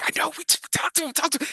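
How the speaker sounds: very high half-whispered squeal; a grown man fanboying with his kid; giddy dad. Acted.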